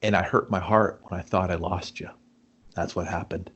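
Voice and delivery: low, soft, sincere admission; tender confession. Retold or imitated. Hurt friend admitting he missed him, tender and hushed. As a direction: confess it quietly, voice dropping away.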